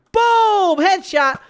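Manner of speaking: loud punchy cheer; triumphant shout. Real, not acted. Gamer calling out his headshot, loud and triumphant. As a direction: bark out the headshot call.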